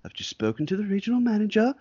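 high-pitched, lightly acted service voice; smooth it over as a clerk, pitched high; placating shop clerk. Clerk back out to the waiting customer, lightly acted high placating voice. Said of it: "come back out there, being like".

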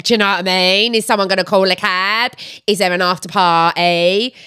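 Essex accent with stretched vowels; dragged-out vowels. Shown as a demonstration. Showing Essex talk after drinks, dragged Essex vowels. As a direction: drag the Essex vowels right out. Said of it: "really drag out our vowels".

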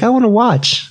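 put-on high, eager little-girl voice; dad mimics his daughter begging to watch, high and eager; imitating his little daughter. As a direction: pipe it up like an excited small child. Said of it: "She's like".

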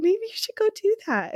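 high, gentle motherly nudge; sweet mom. Retold or imitated. Mom gently urging a sobbing daughter, high and sweet. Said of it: "our mom is so sweet".

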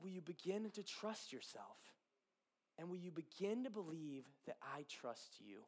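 soft, reverent retold words of God; gentle divine question. Repeating God's gentle question.

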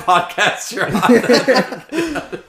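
slow laughing tease. Real, not acted. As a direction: laugh your way through it. Laughing jab at guest.

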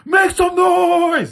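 high, loud, slow "Make some noise" yelled out; rally the crowd like a rock frontman; hyped shout. Shown as a demonstration. Showing how a rock singer should hype the crowd, shouting. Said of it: "Not like".

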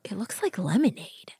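soft unvoiced whisper. Real, not acted. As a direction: whisper it to your friend. Whispering about drink.

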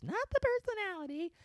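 silly high squeak; mock squeak. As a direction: jump way up into a squeaky voice.

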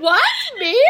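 high, rising mock surprise; imitating surprised mum. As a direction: act out a mum's delighted disbelief. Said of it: "She'll be like".

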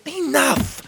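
high, loud cut-off; sharp command. Acted. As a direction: slam the argument shut. Emerald cuts off argument.

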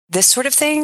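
thing pitched high like a question mark at the end; uptalk demo. Shown as a demonstration. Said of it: "a little question mark at the end of the sentence".

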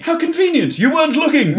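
sarcastic fake shock; mock outrage. Mocking the group hug player.

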